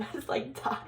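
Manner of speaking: usual speaking voice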